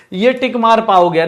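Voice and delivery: ordinary talk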